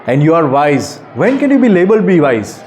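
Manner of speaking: ordinary delivery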